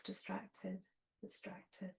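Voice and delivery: very soft, fading murmur; saying it inwardly. Shown as a demonstration. Meditation teacher modelling a silent mental label, very hushed. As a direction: barely voice it, as if to yourself. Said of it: "just say to yourself".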